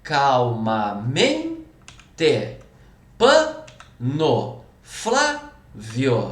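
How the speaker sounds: very slow calmaMENte, PAno, FLÁvio, stressed syllable held, next one dropping; sliding stressed syllable. Shown as a demonstration. Teacher showing stress like a slide, penultimate syllable drawn out. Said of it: "é quase como um escorregador".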